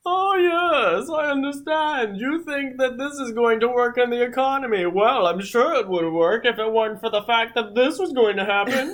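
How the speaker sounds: high, posh rich aristocrat. Retold or imitated. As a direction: sneer like a monocled one-percenter. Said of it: "rich kind of aristocrat voices".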